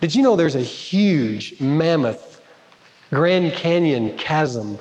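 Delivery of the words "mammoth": usual speaking voice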